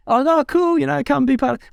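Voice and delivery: imitating a thrilled villager's warm welcome; excited welcome. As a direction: gush like an eager host. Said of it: "and be like".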